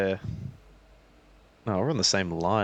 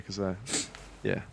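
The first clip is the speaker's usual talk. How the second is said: ordinary talk, nothing marked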